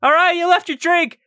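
put-on high, loud childlike yell; calling out. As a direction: holler it shrilly across the room. Calling out to a friend.

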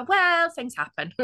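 high, drawn-out "well", feigned nonchalance; mock-breezy retort. Daughter beating mum at her own game, mock-breezy. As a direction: toss it off airily, slow and high.

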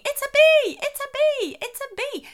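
high-pitched mock shout, tailing off in volume; shrill-pitched repeated yell, loud at first then fading; imitated child shouting. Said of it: "they might be shouting".

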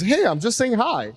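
bright, high eager hello; mock cheery greeting. Newcomer greeting competitive New Yorkers brightly, eager hello. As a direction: chirp a friendly hi at strangers.

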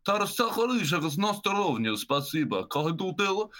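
guttural mock-Russian Irish, down in the throat; Russian-sounding Connemara Irish. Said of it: "They sound Russian out there".

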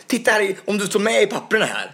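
high, insistent mock yell; retold shouting. Guard dispute over the papers retold, mock shouting. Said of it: "Så bara, och skrek".

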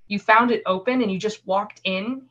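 usual speaking voice

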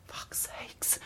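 under-the-breath curse; exasperated whisper. Retold or imitated. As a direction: whisper the swear, fed up. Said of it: "you're like".

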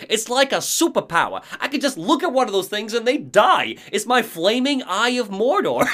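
high, excited Barry impression; mock-heroic Barry bragging. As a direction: ham up the cocky survivalist. Barry voiced in game recap.

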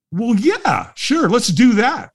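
high-pitched, retold keen yes; eager agreement. Walker agreeing to just watch, eager.

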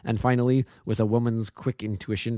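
ordinary delivery, nothing marked

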